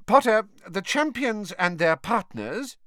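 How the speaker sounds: high, measured professor voice; slow, formal and pitched well up; formal teacher figure. A narrator's reading. Professor briefing her student on the ball, formal.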